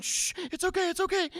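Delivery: high-pitched frantic mock soothing; panicked hushing. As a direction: shush the child in an embarrassed, squeaky panic. Dad hushing crying child in public.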